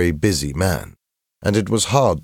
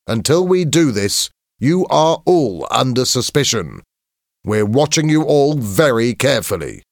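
high, raised, emphatic warning; stern headmaster; 8 semitones higher, 4 dB louder than usual. A narrator's reading. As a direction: warn a whole assembly firmly. Headmaster warns the assembly.

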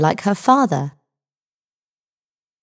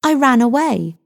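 impatient reply; 7 semitones higher than usual. A narrator's reading. Impatient girl answers the sergeant.

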